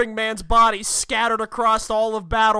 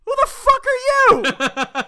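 very high, loud mock outrage; indignant Wrecker; 14 semitones higher, 4 dB louder than usual. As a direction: squawk it as an affronted villain. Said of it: "Wrecker just looks up and goes".

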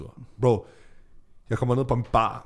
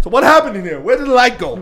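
higher, much louder acted demand; mock loud demand; 10 semitones higher, 12 dB louder than usual. A local confronting the students, loud and higher. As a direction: bark it out as a stunned local.

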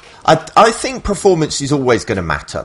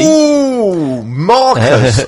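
long high "ooh" sliding into the name; mock scandalised; 5 semitones higher, 4 dB louder, much slower than usual. Host teases co-host over his attitude, mock scandalised. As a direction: playfully tut at a cheeky co-host.

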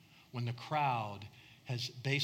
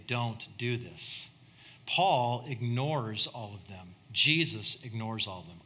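slow, weighty preaching cadence; measured sermon refrain; 4 semitones lower, 4 dB louder than usual. Acted. Preacher contrasting Paul and Jesus, slow and grave. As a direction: lay each parallel down slowly and firmly.

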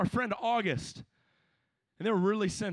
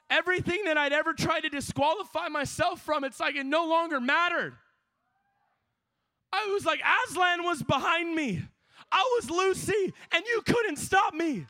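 higher and louder, building to a shouted altar call; full-force preaching; 9 semitones higher, 6 dB louder than usual. Acted. Preacher urging young people to seek anointing, shouting fervently. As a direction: preach it hard, calling the crowd to commit.

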